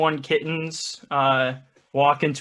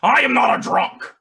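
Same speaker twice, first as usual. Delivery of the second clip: loud, higher emphatic denial; Richard Nixon; 8 semitones higher, 5 dB louder than usual. Acted. Nixons at the bar deny being drunk, indignant outburst. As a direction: burst out with a politician's indignant denial. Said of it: "The Richard Nixons look up and say".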